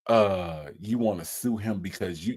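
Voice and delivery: low, soft, hesitant lawyer; puzzled lawyer. Acted. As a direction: question the client in a low, careful voice. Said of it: "the look on the lawyer's face".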